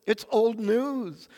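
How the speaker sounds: ordinary delivery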